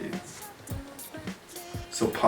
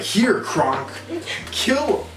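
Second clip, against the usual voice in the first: villain order; 10 semitones higher, slower than usual. Retold or imitated. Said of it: "she's like".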